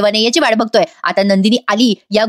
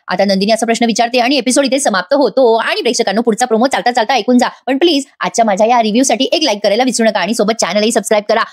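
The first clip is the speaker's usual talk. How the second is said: normal talking voice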